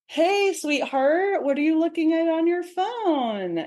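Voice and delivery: high, slow, overly sweet voice; sugary sweet wife. Retold or imitated. Wife sweetly probing her husband about the phone, sugary and high. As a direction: feign softness to sound safe. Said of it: "she's gonna be really nice".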